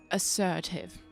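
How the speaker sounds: ordinary delivery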